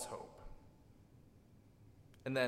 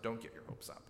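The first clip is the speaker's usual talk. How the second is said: whispered; 2 semitones lower, 8 dB quieter, faster than usual. Shown as a demonstration. Quoting a common warning phrase.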